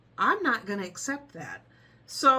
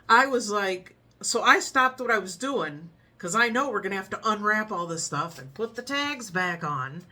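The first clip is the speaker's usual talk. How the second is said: ordinary talk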